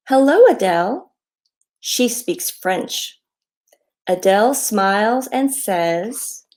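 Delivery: slow down and sing out each greeting; reading to children. A narrator's reading. Author reads her hello book to children, friendly read-aloud.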